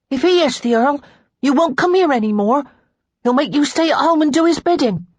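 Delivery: put-on high, loud child's voice; insistent boy, pitched well up; protesting little boy. A narrator's reading. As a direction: argue like an upset small boy. Boy Charles voiced, protesting to Lara.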